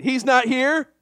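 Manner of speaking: rally this side; lifted rallying call; call to congregation. Shown as a demonstration. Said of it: "Let's try this side".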